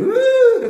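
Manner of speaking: high, loud, held "uh"; put-on thrill squeal; mock stomach-drop whoop. Shown as a demonstration. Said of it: "faz aquele friozinho na barriga".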